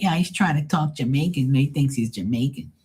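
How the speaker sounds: high, put-on critic voice; mimicking critics. Critics griping about the DJ's accent, mockingly imitated up high.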